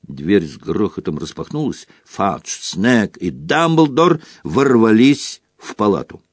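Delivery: usual speaking voice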